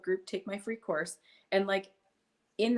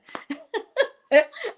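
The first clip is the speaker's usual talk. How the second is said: high burst of laughter; 14 semitones higher, 11 dB louder than usual. Real, not acted. Laughing at falling mid-cartwheel.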